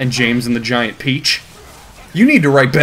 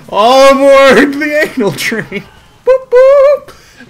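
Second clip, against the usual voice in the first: high, loud, slow hammed-up train call; a silly toot-toot boarding call; mock train conductor; 11 semitones higher, 7 dB louder, much slower than usual. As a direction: announce it like a goofy conductor. Mock announcement of a sex scene.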